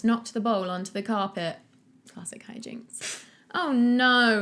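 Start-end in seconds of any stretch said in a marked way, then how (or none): 3.5-4.4 loud moaning oh no. Acted. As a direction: moan it in dismay. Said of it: "he moaned".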